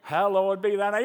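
slow, solemn recital of the Lord's Prayer; formal recited prayer. Retold or imitated. Preacher mocking a calm, formal prayer in a crisis. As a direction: drag it out like rote churchy praying. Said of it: "you don't go".

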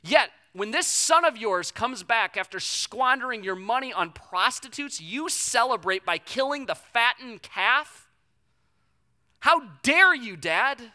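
put-on high, loud, indignant child's complaint; a child whining at a parent, loud and outraged; whiny angry kid. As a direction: rant like a spoiled teenager. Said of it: "your children telling you that".

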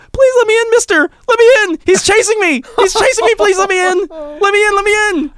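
very high-pitched imitation of a begging kid; mock a panicked kid begging to be let in; pleading little kid. Little kid begging through the door to be let in, mock pleading. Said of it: "it was just a little kid trolling me".

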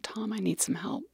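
a soft whispered plea for help. Retold or imitated. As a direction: whisper it to the doctor, barely holding together. Calling doctor while sobbing.

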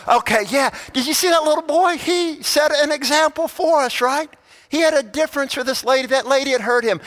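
high, fast, fervent appeal; excited preaching. Acted. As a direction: fire up the congregation. Preacher excited about the example.

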